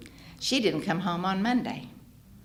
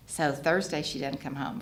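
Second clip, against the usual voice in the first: normal talking voice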